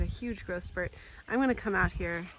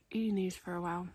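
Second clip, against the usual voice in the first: ordinary delivery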